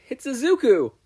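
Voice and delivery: high, loud protest; indignant correction. Acted. Izuku correcting Mei's mistake, loud and high. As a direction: shout the correction back.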